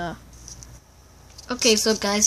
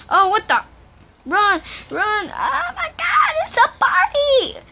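high squealing alarm; excited panic; 13 semitones higher than usual. Real, not acted. Boy seeing a crowd of mobs, high excited squeal. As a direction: shriek it, climbing very high.

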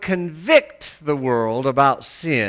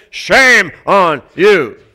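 inner condemning voice; 10 dB louder, much slower than usual. Retold or imitated. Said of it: "I have a voice inside of me that says".

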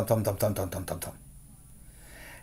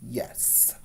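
whispered yes; 13 dB quieter than usual. Acted. Mortimer answers defiantly.

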